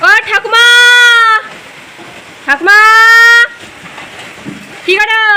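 high, loud call across the yard. Real, not acted. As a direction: call out to grandma from a distance. Calling grandmother across the yard.

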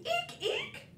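very high squeak with a giggle; squeaky shoe. Pretend. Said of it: "like a squeaky shoe".

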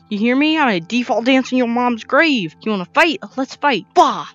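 loud hammed-up battle taunts; trash-talk the monster at full volume; loud taunting. Fighting a mob, yelling taunts.

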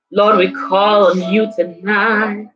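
ordinary talk, nothing marked

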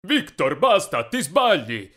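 loud, emphatic reprimand; stern interruption. A narrator's reading. Father stopping his shouting son, loud rebuke. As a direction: cut him off forcefully.

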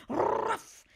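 high, loud barked ruff; dog bark. Acted. Watchdog dashing up to the car, loud bark. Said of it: "exclaimed the watchdog".

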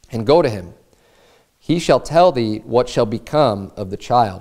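low, measured Bible reading voice; solemn King James reading; scripture reading. Acted. Reads scripture aloud.

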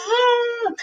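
high buzzing zum; mosquito buzz. A narrator's reading. Mosquito buzzing in the goat's ear, high buzz.

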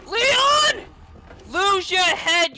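high, loud cry at the character; shouted high and hard; exasperated yell. Real, not acted. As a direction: yell his name in alarm. Yelling at game character in danger.

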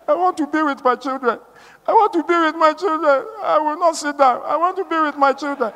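high mock-tearful insistence; imitated tearful plea. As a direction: plead like a crying sister, imitating her. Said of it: "Why are you crying?".

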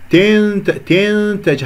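تنتج with the vowel swallowed into the nasal n; clipped kasra. Shown as a demonstration. Teacher repeats the mistake, تنتج with snatched kasra. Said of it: "هذا الصوت خطأ".

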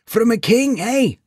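put-on high, amused old man; chuckling old codger asking; old villager voice. A narrator's reading. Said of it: "said the old man with a chuckle".

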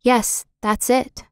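a polite, measured little reply. A narrator's reading. Benny agreeing with the visitor, politely. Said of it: "said Benny politely".

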